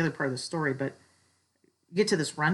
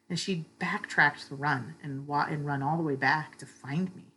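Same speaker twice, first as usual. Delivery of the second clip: ordinary talk, nothing marked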